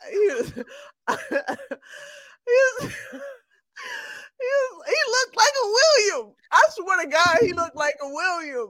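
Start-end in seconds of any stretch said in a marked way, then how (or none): 0.0-6.3 laughing. Real, not acted. Laughing through the story.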